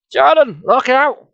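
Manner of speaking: high, loud warning cry; hysterical shout. A narrator's reading. Nicole warning Jordan about the rock, hysterical. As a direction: shout an urgent warning in alarm. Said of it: "Nicole shouted hysterically".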